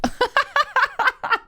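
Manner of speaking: long, high giggle; high laughter. Real, not acted. Host cracking up at a listener's joke, high laugh.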